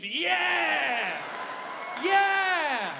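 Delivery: loud, high whoop of celebration; rally the crowd to cheer; big rowdy whoop; triumphant cheer. Real, not acted. Cheering the pirate-themed birthday.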